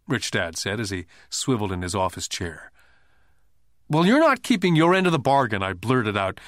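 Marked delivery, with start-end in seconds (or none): 3.9-5.6 near tears. A narrator's reading. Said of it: "nearly in tears".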